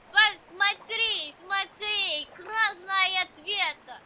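loud, slow urging in Russian; mock-dramatic, slow and loud; emphatic showing off. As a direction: insistently show it off, drawn out. Keeps proclaiming, slipping into Russian.

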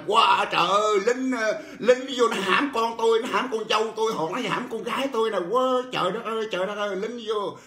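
high-pitched mock wail of a village woman; crying out for help. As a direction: retell her outcry, pitched high and half-acted. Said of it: "quanh mỏ la làng lên". (giả giọng la làng the thé)

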